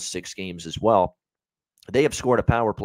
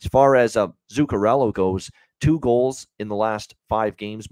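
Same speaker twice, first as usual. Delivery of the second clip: normal talking voice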